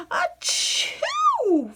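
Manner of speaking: very high, loud shouted achoo; sneeze. A narrator's reading. Louise sneezing on the spelling lists, huge shouted sneeze. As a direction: let rip an enormous sneeze.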